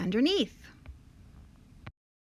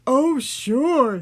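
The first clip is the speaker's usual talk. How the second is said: loud monster voice, mildly amused; amused Gruffalo; 3 semitones higher, 8 dB louder than usual. A narrator's reading. Gruffalo doubts mouse, loud and mildly amused. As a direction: scoff as a big storybook beast. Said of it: "bursting with laughter".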